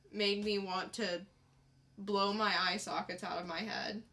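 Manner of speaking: ordinary talk, nothing marked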